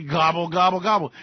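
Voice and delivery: mock turkey gobbling; turkey gobble. Turkey noise imitation.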